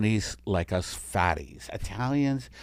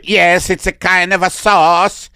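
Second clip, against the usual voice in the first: put-on Italian alien accent; 7 semitones higher, 6 dB louder than usual. Italian alien telling the doctor his first name, Italian accent. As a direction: introduce yourself grandly in Italian. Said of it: "you need to get a better Italian accent".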